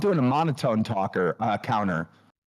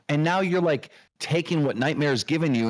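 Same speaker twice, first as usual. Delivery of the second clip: usual speaking voice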